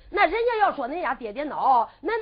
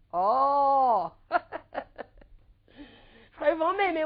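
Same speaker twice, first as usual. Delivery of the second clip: crying into laughter; much slower than usual. Acted. Girl cries then laughs.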